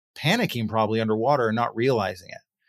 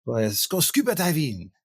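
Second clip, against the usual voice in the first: hammed-up French accent, pitched higher; 4 semitones higher than usual. Salesperson inviting a coworker to dive, in a mock French accent. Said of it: "That's my French accent".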